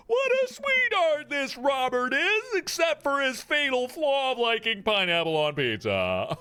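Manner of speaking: high, slow, swooning gush; drawn-out lovestruck swoon. Acted. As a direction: coo it like you're smitten. Gushing over Robert.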